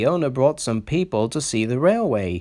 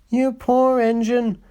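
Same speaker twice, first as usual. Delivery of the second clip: high, sympathetic coo; sympathizing; 13 semitones higher, 3 dB louder than usual. A narrator's reading. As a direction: feel sorry for him out loud. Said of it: "sympathized James".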